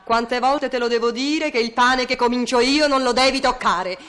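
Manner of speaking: high scolding nagging wife; shrill scolding Italian mother. Acted. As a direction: nag him about the bread. Scolding about the bread.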